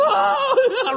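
very high, loud cry of joyful surprise; a laughing shriek of recognition; joyful gasp. Retold or imitated. Mary recognizing Jesus, laughing joyful cry. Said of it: "She's like".

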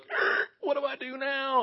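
mock helpless panic after a strangled groan; freaking out. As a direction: softly squeal it like someone lost. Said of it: "you're freaking out".

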